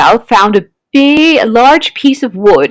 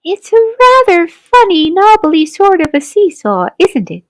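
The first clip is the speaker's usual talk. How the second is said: put-on older girl, doubtful; 7 semitones higher than usual. A narrator's reading. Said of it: "a girl who was older".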